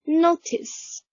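notice said high and drawn out; high teacher model of notice. Shown as a demonstration. Teacher models notice high for students. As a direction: read notice up high for the class to copy. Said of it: "Notice，notice，notice，notice。".

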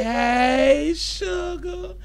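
high, rising drawn-out greeting; playful greeting call. Pretend. Host greeting a New York viewer, playful rising call.

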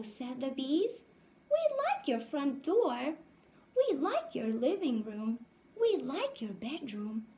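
lively storybook read-aloud voice; reading to children. A narrator's reading. Teacher reading a picture book to her class, lively. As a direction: read it out brightly to the little ones.

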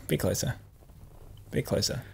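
whispered coaching. Real, not acted. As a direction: hush it, lean in. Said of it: "coaching the sniff".

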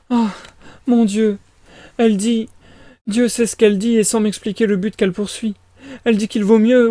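high-pitched flustered mother; fretful mother character. Acted. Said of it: "je me suis pas trompé de perso".

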